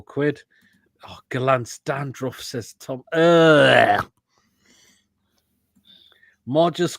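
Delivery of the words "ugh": loud, drawn-out "ugh" of disgust; real revolted groan, much louder than the talk. Real, not acted. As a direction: groan loudly in revulsion. Reacting to a disgusting comment.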